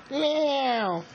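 high falling kitty meow; a pretend cat's meow. Toy cat greeting the baby, high meow.

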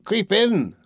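high, coaxing witch voice; sly witch. A narrator's reading. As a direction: lure her in as the witch. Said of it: "said the witch".

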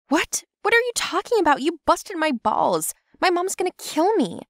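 high-pitched indignant protest; outraged. Acted. Girl blaming him for broken eggs, high indignant outburst.

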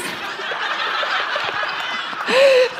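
breathless, high, wheezing laugh; laughing too hard to make sound; silent laugh. Real, not acted. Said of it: "So I'm crying".

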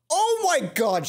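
real loud, high burst of disbelief; excited. Real, not acted. Player reacting to Mai's confession, excited. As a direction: blurt it out, shocked.

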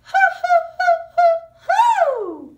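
very high, very loud falling hoots; tricky owl hoots for the class. Acted. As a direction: hoot as high as you can, tricky. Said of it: "tricky ones".

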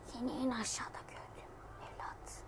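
menacing sniper whisper; soft taunting whisper to the prey. Pretend. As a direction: breathe it to the target below. Aiming at the animal from above.

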